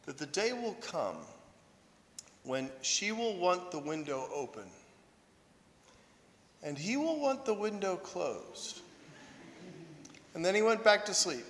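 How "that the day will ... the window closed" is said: lightly voiced old monk, soft, with long pauses; old monk's counsel. Drowsy old monk advising a priest on a wedding toast, lightly voiced sage counsel. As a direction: hand down cryptic wisdom quietly and deliberately. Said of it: "I went and found an old monk".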